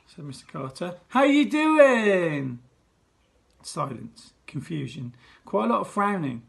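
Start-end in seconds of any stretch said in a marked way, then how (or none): 1.1-2.6 high, loud, slow TV presenter greeting; head teacher greeting school like a TV show; showbiz host. Acted. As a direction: work the crowd like a game show host.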